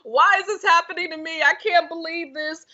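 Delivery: mock self-pity. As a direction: imitate your past self whining at fate. Mock self-pity while struggling.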